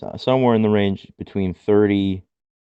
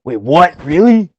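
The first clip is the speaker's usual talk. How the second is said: surprised; 7 semitones higher, 7 dB louder than usual. Retold or imitated. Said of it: "I go".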